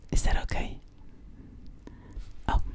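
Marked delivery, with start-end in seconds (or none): none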